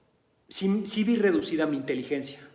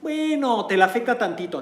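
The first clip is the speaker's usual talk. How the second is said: dismissive psychiatrist; 7 semitones higher, 4 dB louder than usual. Retold or imitated. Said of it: "Los psiquiatras te van a decir".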